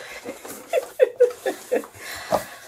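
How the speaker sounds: high laughing squeal; shrieking laugh. Real, not acted. Vlogger seeing the package contents, squealing laugh.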